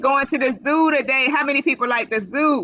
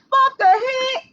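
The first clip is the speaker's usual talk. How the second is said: mock alarm; 4 dB louder, slower than usual. Bunny falls in story.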